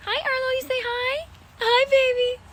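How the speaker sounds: very high squeaky coo. Real, not acted. Cooing to horse.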